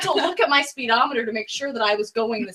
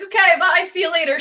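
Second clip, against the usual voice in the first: cheery goodbye; 5 semitones higher, 6 dB louder than usual. Retold or imitated.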